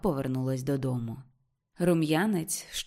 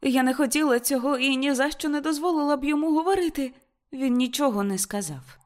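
high and loud, breathless protest; excited girl's voice; 8 semitones higher, 4 dB louder than usual. Acted. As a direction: insist to mother, higher and louder. Ruth pleading excitedly with mother.

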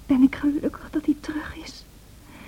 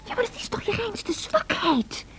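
scornful whisper; 8 semitones higher, 6 dB quieter than usual. Acted.

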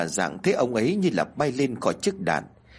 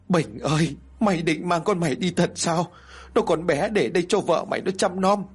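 higher, pleading wail to her dead son; bursting into tears; 6 semitones higher than usual. Acted. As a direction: grandmother sobbing out her grief, pitch rising. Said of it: "Bà Dạng lúc này liền òa lên khóc".

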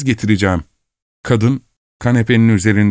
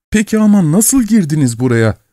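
haykırarak; 7 semitones higher than usual. A narrator's reading. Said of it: "diye haykırdı".

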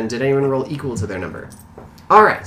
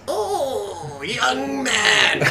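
put-on much higher-pitched old man's voice; wizened old man; 12 semitones higher, much slower than usual. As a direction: put on a high, doddering elder's voice. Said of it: "this wizened old man".

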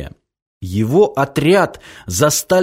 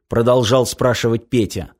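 plain reading voice

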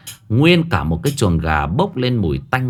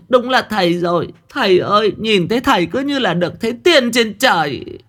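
much higher, fawning woman's voice, begging; pleading village woman; 11 semitones higher than usual. Acted. Village woman kneels and begs the master to save her chickens, high pleading. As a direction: kneel and beg the great master.

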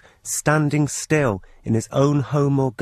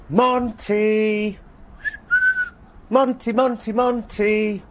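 calling the cat in a much higher, carefree sing-song; cheerful high-pitched call; 12 semitones higher, much slower than usual. Retold or imitated. Husband calling the cat from the back door, high cheerful call. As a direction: sing out the cat's name high and happy. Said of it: "shout for our cat in a cheerfully high-pitched".

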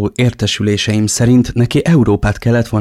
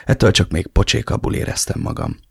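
ordinary delivery

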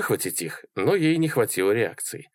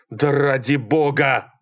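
drawn-out, higher-pitched shout; exasperated yell; 5 semitones higher, 3 dB louder, slower than usual. A narrator's reading. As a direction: yell it, gulping for air. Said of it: "орал Малькольм, глотая воздух". (протяжный раздражённый крик)

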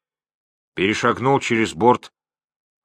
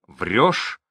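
plain reading voice

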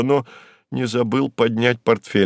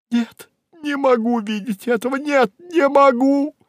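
distraught cry; 13 semitones higher than usual. Acted. Secretary fleeing the office, distraught cry. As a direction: cry out very high, unable to bear it. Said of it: "закричала Анна Ричардовна".

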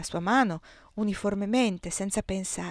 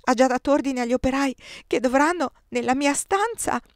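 louder, higher, on the edge of tears; breaking into sobs; 6 semitones higher, 6 dB louder than usual. A narrator's reading. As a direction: let it crumble toward weeping. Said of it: "si smarrì in un pianto dirotto".

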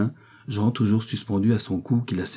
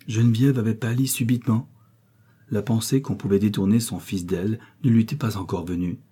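usual speaking voice

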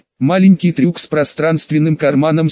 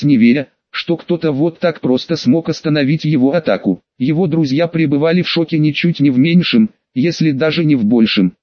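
ordinary delivery, nothing marked

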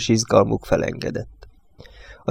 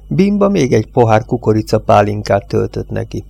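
ordinary delivery

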